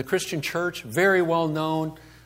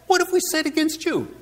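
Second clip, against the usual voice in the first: mock indignant question; 14 semitones higher, faster than usual. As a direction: throw the question back, pitched right up. Acting out the people's challenge to God.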